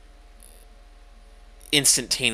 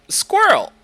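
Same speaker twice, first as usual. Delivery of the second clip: excited; 14 dB louder than usual. Real, not acted. Excited card call.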